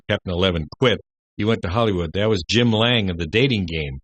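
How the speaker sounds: ordinary talk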